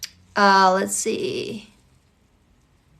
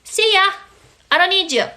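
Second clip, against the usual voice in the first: breezy dismissal; 7 semitones higher than usual. Retold or imitated. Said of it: "you go".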